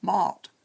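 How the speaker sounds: "mart" with a long open AH; English vowel. Shown as a demonstration. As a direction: say "mart" as the middle of British "tomatoes". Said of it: "with the middle of tomatoes pronounced".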